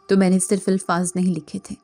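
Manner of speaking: usual speaking voice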